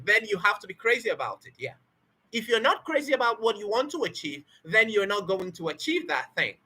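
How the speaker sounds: ordinary delivery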